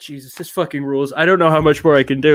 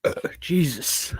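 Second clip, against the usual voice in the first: ordinary talk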